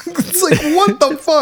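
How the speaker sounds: high, laughing what the fuck; laughing disbelief. Real, not acted. Incredulous, laughing.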